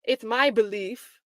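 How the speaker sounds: a hint of the lawyer's grand tone; mock quote. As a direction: lean hard on "belief", drawn out and pompous. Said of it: "She never says".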